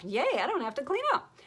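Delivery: high, bouncy mock cheer; excited. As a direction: cheer it in a lifted, happy sing-song. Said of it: "now you're excited".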